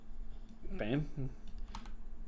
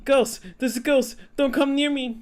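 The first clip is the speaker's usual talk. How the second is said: panicked scream; 10 semitones higher than usual. Acted. Companion spotting a ghost, high panicked shouting. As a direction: shriek like you've seen a ghost.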